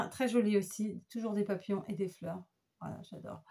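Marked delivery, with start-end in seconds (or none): 2.8-3.4 whispered in delight, barely voiced; soft whisper. Real, not acted. Admiring the tags sent by a friend, whispered. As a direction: breathe the words out as a hushed aside.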